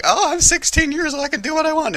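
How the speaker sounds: high whiny teenage mock; bratty teen voice. Daughter talks back to father, high bratty whine. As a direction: sass it like a sulky sixteen-year-old. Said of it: "Ariel's like".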